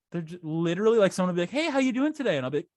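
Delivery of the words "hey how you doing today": high-pitched, chirpy mock greeting; perky, pitched-up small talk; friendly stranger. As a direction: imitate an overly nice stranger.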